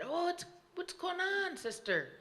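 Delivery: high, lightly voiced big brother; kind brother voice. A narrator's reading. Brother asking his cold sister, high caring voice.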